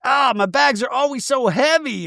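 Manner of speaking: high-pitched imitated complaint; mock lament about lugging luggage; Mary Cosby. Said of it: "Mary Cosby's like".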